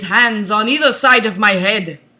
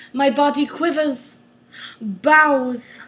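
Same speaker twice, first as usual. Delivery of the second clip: normal talking voice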